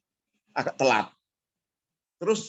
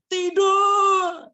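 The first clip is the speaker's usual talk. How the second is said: much higher, loud defiant shout; rallying shout; 5 dB louder, much slower than usual. Retold or imitated. The family answering their leader, a loud rallying shout. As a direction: shout it like a battle cry. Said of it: "jawabannya langsung spontan".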